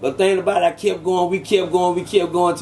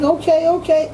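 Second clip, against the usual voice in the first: much higher, taken-aback okay; flustered agreement; 11 semitones higher than usual. Retold or imitated. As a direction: answer caught off guard and a bit nervous. Flustered reply to the mother.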